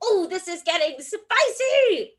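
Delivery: high-pitched thrill at the bid; excited. Real, not acted. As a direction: squeal it, over the moon. Bid rising in auction.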